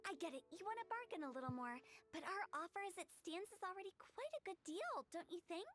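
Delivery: normal talking voice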